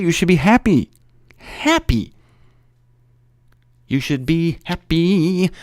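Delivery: sudden high sing-song happy; playful high-pitched happy; mock cheerful. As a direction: squeak the word happy up high.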